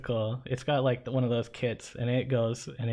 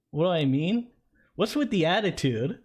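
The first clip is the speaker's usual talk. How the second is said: higher, sharper cop mimicking back; mocking cop; 5 semitones higher than usual. Cyclist imitating the cop mocking his question, higher. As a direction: throw the question back at him like a smug cop.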